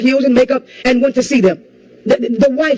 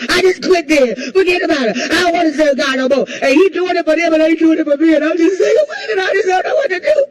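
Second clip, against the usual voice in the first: mock despairing quitter; 3 semitones higher than usual. Acting out a discouraged believer.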